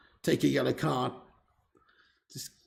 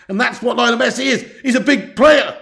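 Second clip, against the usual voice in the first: high, loud and excited; emphatic praise; 7 semitones higher, 7 dB louder than usual. Real, not acted. Streamer declaring Messi a big player, loud and emphatic. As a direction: drive it home to the viewers. Praising the scorer after goal.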